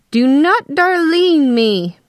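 high, loud, drawn-out indignation; angry retort. A narrator's reading. Wife rounds on her calming husband, drawn-out outrage. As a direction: stretch each word in outraged warning.

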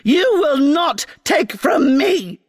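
screeching queen. Acted.

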